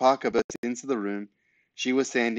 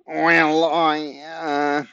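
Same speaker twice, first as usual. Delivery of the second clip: higher, flustered nasal stammer; nasal nosy neighbour; 5 semitones higher, 6 dB louder than usual. A narrator's reading. Neighbour defends his spying to granny, flustered nasal voice. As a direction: bluster defensively through the nose. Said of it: "had a very nasal voice".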